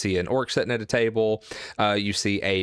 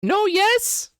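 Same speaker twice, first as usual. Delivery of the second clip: very high, loud squeaky puzzlement; orc guard; 5 dB louder than usual. Acted. Orc guard confused by the mixed answer, high squeaky voice.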